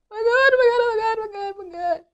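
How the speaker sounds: high loud panicked oh my Gods; screaming at a spider. Real, not acted. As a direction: scream it in disgust. Squishing the spider.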